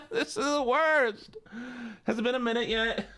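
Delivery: high, whiny character voice; squeaky put-on voice, protesting. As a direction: pitch it up high and keep it there. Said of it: "one minute of this voice".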